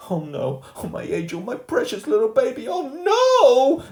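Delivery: moaning mother. A narrator's reading. Mum clutching Fudge, moaning and rising to a panicked cry. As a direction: panic building to a wail. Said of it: "My mother moaned".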